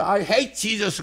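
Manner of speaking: high, mock heckler's yell; retold hostile shout. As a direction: imitate someone shouting in hostility. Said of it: "소리쳤죠".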